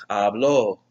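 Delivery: habló with the weight on the ó; stress on final O. Shown as a demonstration. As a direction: land firmly on the last syllable of habló. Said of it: "with an accent on the O".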